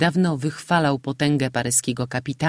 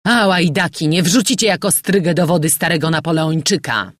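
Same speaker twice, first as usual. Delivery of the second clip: defiant shout; 3 semitones higher, 5 dB louder than usual. Acted. General fights off the pirates.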